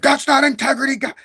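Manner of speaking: loud, high-pitched shouted objection; animated outburst. Shown as a demonstration. Imitating their old self objecting, loud and animated. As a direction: burst out sharply, way up in pitch. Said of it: "As in the past, I'd be like".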